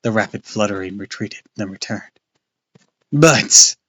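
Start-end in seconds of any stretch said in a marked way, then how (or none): 3.1-3.8 terrified cry. A narrator's reading. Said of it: "Clay cried in a terrified, tiny voice".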